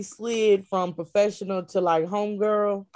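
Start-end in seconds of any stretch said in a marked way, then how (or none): none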